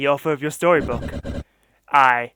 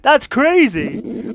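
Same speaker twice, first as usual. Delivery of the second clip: drawn-out high amazement; stunned exclamation; 9 semitones higher, 3 dB louder, much slower than usual. Real, not acted. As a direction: stretch it out in disbelief. Reacting to the twist.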